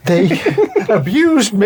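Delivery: giggling through Chandler's line; laughing while quoting. Retold or imitated. Chandler complaining, laughed through. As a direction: quote it while cracking up. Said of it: "he's like".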